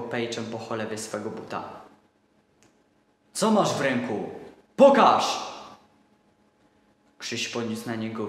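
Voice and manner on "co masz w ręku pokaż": put-on bully the child into showing; menacing shout at a boy; harsh interrogation.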